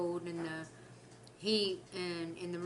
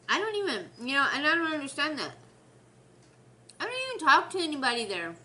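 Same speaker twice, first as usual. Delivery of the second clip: higher, louder and fast; exasperated rant; 6 semitones higher, 5 dB louder, faster than usual. Real, not acted. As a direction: rattle it off, exasperated. Exasperated rant about coworker.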